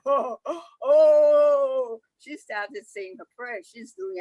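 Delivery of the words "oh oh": louder wailing sobs; scared child crying. Acted. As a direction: sob like a scared little girl. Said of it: "she started crying".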